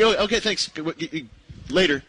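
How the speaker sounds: high, quick brush-off; dismissive. Retold or imitated. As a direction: wave him off fast. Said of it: "trying to shuffle him off".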